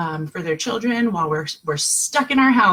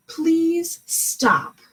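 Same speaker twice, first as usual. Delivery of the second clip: higher, firm third please stop; calm firm request; 9 semitones higher than usual. Shown as a demonstration. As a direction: demonstrate a firm final ask. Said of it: "you try it a third time".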